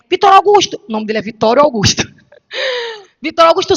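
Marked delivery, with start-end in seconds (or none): 0.1-0.8 calling her son. Retold or imitated. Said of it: "Eu digo assim a ele". 3.2-3.8 laughing call. Retold or imitated. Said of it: "Eu digo assim a ele".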